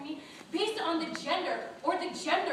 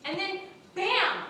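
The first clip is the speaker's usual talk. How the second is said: a drawn-out, dramatic "bam"; comic revelation; 7 semitones higher, 5 dB louder, slower than usual. Retold or imitated. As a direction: punch it out, higher and slow. Announces sudden revelation.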